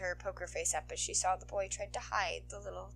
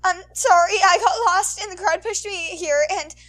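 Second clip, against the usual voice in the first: much louder, higher, tearful rush; bawling boy; 12 semitones higher, 18 dB louder than usual. Acted. Lost boy sobbing apologies to the heroine, bawling. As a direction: cry it out all at once. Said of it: "started bawling".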